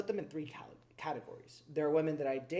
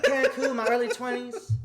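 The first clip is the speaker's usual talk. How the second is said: higher, louder mock Southern lady; Old South accent; 7 semitones higher, 8 dB louder than usual. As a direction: replay the Southern accent over the laughter. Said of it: "She had the Old South accent".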